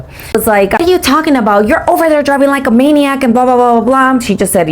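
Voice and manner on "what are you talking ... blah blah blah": high, indignant mimicry trailing into blahs; mock teenage rant. Teenager arguing back from her car, high mocking imitation. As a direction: mimic a nagging teen, pitched up.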